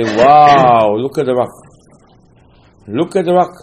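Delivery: loud, wide-eyed wow at the rock; amazed crowd. Retold or imitated. People of Israel marvelling at the rock, excited wow. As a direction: marvel like a crowd seeing a miracle.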